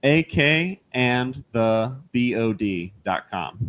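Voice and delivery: spelled out slowly, letter by letter; slow, deliberate, each letter clear. Shown as a demonstration. Spelling out website.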